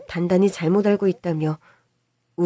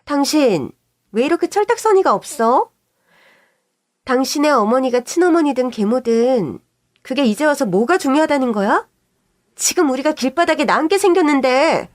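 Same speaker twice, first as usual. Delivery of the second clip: higher, louder angry outburst; burst of anger; 3 semitones higher, 3 dB louder than usual. Acted. Wife blowing up at her husband, angry shout. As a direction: flare up and scold him hard. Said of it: "형님이 버럭 화를 내더라고요".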